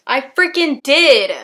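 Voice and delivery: loud, slow, triumphant; excited. Real, not acted. Proud she said the long title right, loud excited cheer. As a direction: crow it out like you nailed it.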